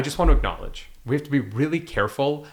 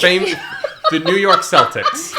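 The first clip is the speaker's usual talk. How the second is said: hammed-up Boston accent, slow; playful Boston accent; mock Boston accent; 6 semitones higher, 7 dB louder, slower than usual. Joking fake Boston names.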